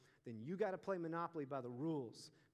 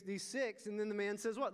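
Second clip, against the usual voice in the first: usual speaking voice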